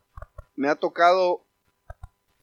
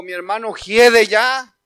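slow, louder objection; grieving sister; 3 semitones higher, 10 dB louder, slower than usual. Retold or imitated. Martha objecting to Jesus at the tomb, louder. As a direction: protest that he's already dead.

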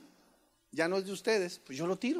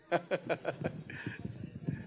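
breathy silent laugh; 11 dB quieter than usual. Real, not acted. Laughter at the joke.

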